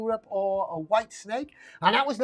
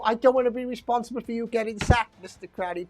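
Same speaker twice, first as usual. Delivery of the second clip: ordinary talk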